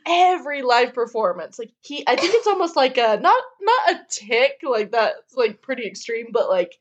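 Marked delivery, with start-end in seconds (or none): none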